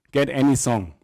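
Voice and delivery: ordinary delivery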